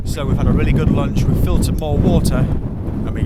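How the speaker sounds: loud over the wind; shouting over wind. Real, not acted. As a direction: raise your voice above the gusts.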